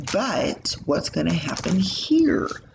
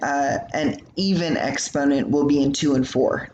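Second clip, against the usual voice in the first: ordinary delivery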